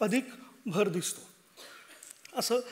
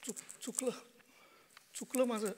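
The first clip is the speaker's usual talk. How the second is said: much softer, broken confession; remorseful admission; 13 dB quieter than usual. Acted. Father quietly admits to his son he was wrong. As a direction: barely voice the apology.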